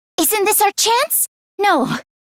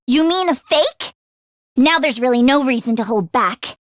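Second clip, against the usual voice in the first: usual speaking voice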